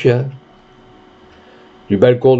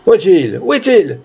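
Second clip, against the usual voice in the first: urgent question, leaping much higher on the repeat; agitated crowd cry; 6 semitones higher than usual. A narrator's reading. Townsfolk hunt the mad dog, agitated cry. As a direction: call out urgently, pitch climbing.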